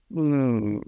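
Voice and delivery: low, drawn-out doubtful hmm; skeptical hum. Retold or imitated. Father unsure about son's circle mirror, low doubtful hum.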